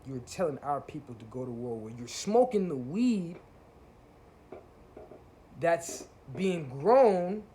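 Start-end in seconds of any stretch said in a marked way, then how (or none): 2.0-7.4 slow, higher and louder, hammering each word; heated rant, louder. Real, not acted. Calling out YouTubers who preach war, slow, loud and higher. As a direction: build the outrage word by word.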